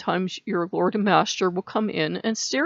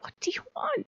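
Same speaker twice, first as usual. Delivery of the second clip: much higher, much softer plea; a small helpless squeak of a question; high soft bewildered question; tiny exasperated what; 11 dB quieter than usual. Retold or imitated. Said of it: "I'm like".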